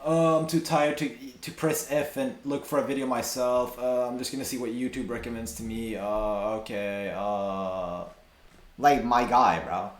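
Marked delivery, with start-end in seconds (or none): 0.0-8.0 mock lazy. Streamer mocking a lazy search for videos, mock lazy. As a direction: play someone who can't be bothered. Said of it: "this is the laziest shit".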